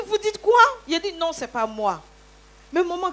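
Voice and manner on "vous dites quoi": retold indignant shout. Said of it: "c'est des cris que j'entends".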